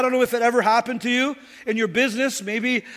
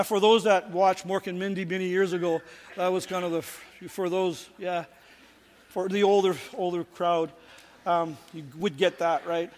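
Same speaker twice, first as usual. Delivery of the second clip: quiet aside; 6 semitones lower, 6 dB quieter than usual. Real, not acted. Aside about an old TV show.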